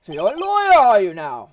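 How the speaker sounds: loud, swooping lawyer role voice; pompous Grossberg. Acted. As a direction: exclaim high in grand recognition. Grossberg notices the lawyer's badge.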